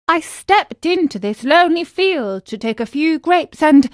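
lightly voiced high-pitched puppet; a hint of a pleading marionette. A narrator's reading. Trapped puppet explains himself to glowworm.

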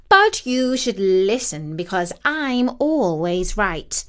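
slow, superior cat voice; smug witchy cat. Acted. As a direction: lay out the scheme like a know-it-all cat.

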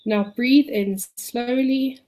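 calm the listener, long pauses. Acted. Leads a relaxation exercise.

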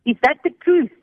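high, mild mock yell; retold yelling. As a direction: demand it like a soldier interrogating, half-shouted. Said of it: "They said, yelling again".

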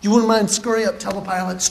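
ordinary talk

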